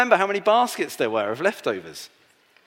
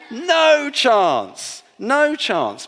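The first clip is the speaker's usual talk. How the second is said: big emphatic NO CHANCE; 7 semitones higher, 8 dB louder, much slower than usual. Real, not acted. Children's talk, emphatic answer.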